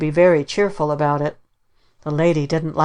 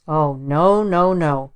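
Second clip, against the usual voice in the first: protesting; slower than usual. Acted. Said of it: "protested the lady".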